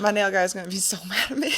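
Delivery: amused confession breaking into a laugh; real laugh in the voice; laughing while talking. Real, not acted. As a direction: giggle through the words. Laughing about nail guy's anger.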